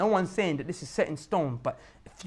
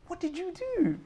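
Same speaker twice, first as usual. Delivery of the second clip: high, drawn-up wondering question; high-pitched question; 9 semitones higher, 4 dB quieter, slower than usual. Acted. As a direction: lift the repeat right up high. Same question repeated higher.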